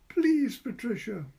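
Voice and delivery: high, loud slow plea; begging her friend to come. A narrator's reading. Edith begging.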